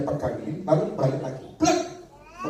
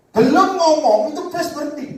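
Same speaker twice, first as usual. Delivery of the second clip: much higher and louder, rushing; preacher hitting the story's climax, much higher and louder; excited storytelling; 12 semitones higher, 8 dB louder than usual. Real, not acted. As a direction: build the suspense, voice leaping up.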